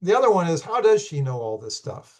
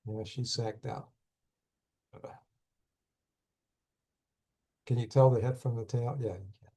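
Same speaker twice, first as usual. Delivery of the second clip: hushed aside; 2 semitones lower, 8 dB quieter than usual. Real, not acted. Quiet aside about sleeping pet.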